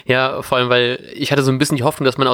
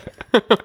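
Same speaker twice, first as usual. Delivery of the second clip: high burst of laughter; 8 semitones higher than usual. Real, not acted. Laughs at own mistake.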